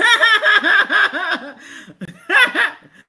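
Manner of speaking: high, helpless cackling; real loud laughing fit; loud burst of laughter. Real, not acted. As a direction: crack up completely. Bursts out laughing at own turn.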